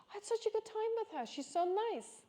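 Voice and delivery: high, fast, gushing retelling of mom; gushing mom quote. Retold or imitated. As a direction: imitate mom raving about her, fast and high. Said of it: "she tells me".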